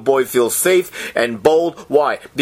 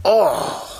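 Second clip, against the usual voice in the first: 1 overcome with emotion; 14 semitones higher than usual. Real, not acted. Moved by the scripture reading.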